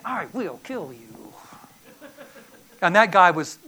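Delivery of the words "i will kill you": menacing threat. Retold or imitated. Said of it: "and he says".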